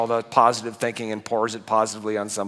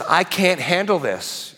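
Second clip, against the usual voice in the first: quoting a struggling woman; 6 semitones higher, slower than usual. Retold or imitated. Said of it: "and she says".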